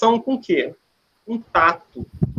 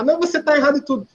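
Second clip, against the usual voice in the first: mock correction; 7 semitones higher, much faster than usual. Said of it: "vê aquele monte e fala".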